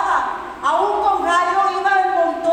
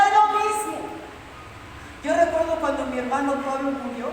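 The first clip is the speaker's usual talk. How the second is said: usual speaking voice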